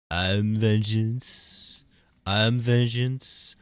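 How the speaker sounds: slow, drawn-out villain boast; hammed-up vengeance boast; mock menace. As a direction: lean into each word slowly. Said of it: "reciting a vengeance bit".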